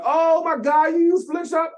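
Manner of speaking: high, loud, starstruck squeal; excited gush. Retold or imitated. Friend at an LA party, thrilled to see [name] using the app, squealing high and loud. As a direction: gasp it like she's spotted a celebrity. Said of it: "And she's like".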